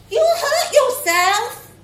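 high, slow, worried warning; earnest child's warning. Acted. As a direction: plead with her to hold on. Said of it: "we warned".

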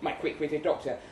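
usual speaking voice

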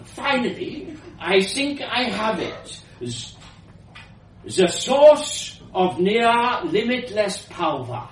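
put-on grand old inventor, slightly foreign; elderly foreign woman. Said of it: "elderly woman's voice".

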